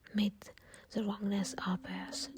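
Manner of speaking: usual speaking voice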